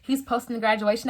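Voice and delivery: ordinary talk, nothing marked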